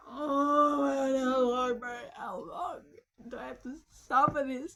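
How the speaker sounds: drawn-out, overblown cry of suffering; mock anguish. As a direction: wail it out in hammed-up despair. Mock agony at long wait.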